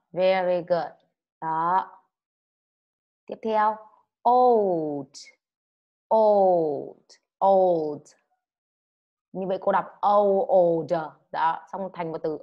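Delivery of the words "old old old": put-on loud "old" with the final D clearly sounded. Shown as a demonstration. Teacher drills a clear final D for pupils, loudly. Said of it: "nói rõ là đờ nhá".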